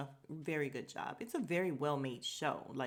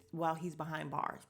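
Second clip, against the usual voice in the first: normal talking voice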